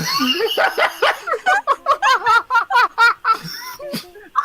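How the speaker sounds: howling high laughter; loud laughing fit. Real, not acted. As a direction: burst out laughing hard. Hearing the bad voice acting.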